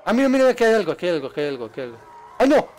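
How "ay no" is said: loud high jolt of fright; real startle; startled cry. Real, not acted. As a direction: yelp when it jumps out. Startled by something in game.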